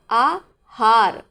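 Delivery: आहार stretched very slowly with a long open aa; आहार said slowly, long aa then ha; drawn-out correct aahaar. Shown as a demonstration. Teacher drawing out आहार slowly to show the long aa. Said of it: "उच्चारण सही करेंगे".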